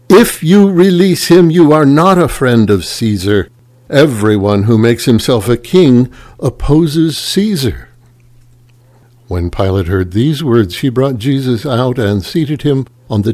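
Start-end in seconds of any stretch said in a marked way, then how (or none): 0.1-7.9 much higher, louder threatening cry; crowd crying out. A narrator's reading. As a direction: cry out to force Pilate's hand.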